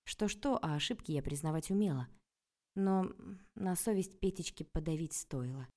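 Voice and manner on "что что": ordinary delivery, nothing marked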